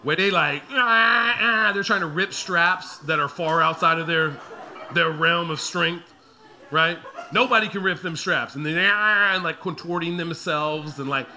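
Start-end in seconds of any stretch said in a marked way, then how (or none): 0.7-1.7 mock scream. Toddler screaming in car seat. 8.8-9.4 mock scream. Toddler screaming in car seat.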